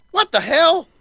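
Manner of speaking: high-pitched retold bewilderment; incredulous high squeak of a question; baffled disbelief. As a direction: act out being baffled, voice shooting way up. Acting out own bewildered reaction.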